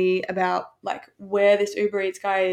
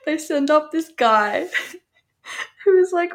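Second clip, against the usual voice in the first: laughing while talking; 6 semitones higher, 4 dB louder than usual. Real, not acted. Telling listeners about the man reception sent, laughing. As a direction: barely hold back real laughter, pitch climbing.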